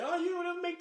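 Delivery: high mocking whine of a demanding woman; mocking the girlfriend. Man imitating his woman's nagging in a high whiny voice. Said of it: "flip the script and say".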